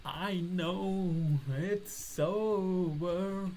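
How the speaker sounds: milk the tragedy, stretching it out; hammed-up lament. Dramatic mock lament.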